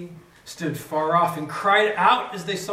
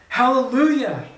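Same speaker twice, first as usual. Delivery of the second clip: higher, louder ringing Hallelujah; cried hallelujah; 7 semitones higher, 7 dB louder than usual. Acted. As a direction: lift it like a crowd's shout of praise. They cried out once more.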